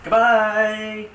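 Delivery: high drawn-out goodbye fading away. Acted. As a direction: call goodbye as it fades out. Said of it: "my nice patented fade outs".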